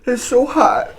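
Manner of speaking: very high, laughing through the burn; pained giggly squeak; squealing laugh. Real, not acted.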